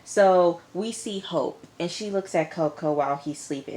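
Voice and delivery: normal talking voice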